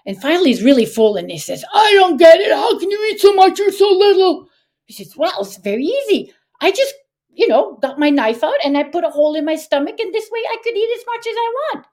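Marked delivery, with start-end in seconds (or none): 1.7-4.5 high, loud exasperated cry; baffled troll. Acted. Overfull troll to the little boy, baffled shouting. 5.2-11.9 high, light explanation, speeding up; breezy trickster. Acted. Ash lad fooling the troll, breezily casual.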